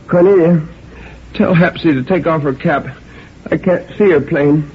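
high-pitched call from the sickbed; querulous old woman. A narrator's reading. Granny asking Cornelia about Hapsy's cap, querulously.

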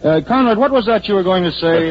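high-pitched, flustered hand-off; flustered appeal. Acted. Stuck speechmaker turns to Conrad for help, flustered. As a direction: pitch jumps up as you grope for rescue. Stuck speaker hands over to host.

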